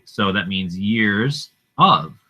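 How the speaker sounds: "years of" slowed, leaning on "of"; slow emphatic "years of"; stress on of. Shown as a demonstration. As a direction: drag out "years of", ending on "of". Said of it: "instead of im, it's a".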